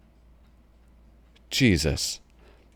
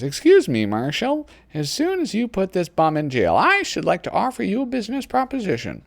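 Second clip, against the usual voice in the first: put-on girl voice; 11 semitones higher than usual. Reads girl in True Grit comic.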